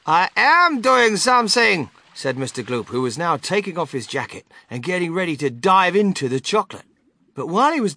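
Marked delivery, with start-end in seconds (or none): 0.0-1.9 slow, loud, raised-pitch protest; exasperated husband defending himself; indignant retort. A narrator's reading. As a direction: answer back loudly, stretching the words. Husband retorts to screaming wife.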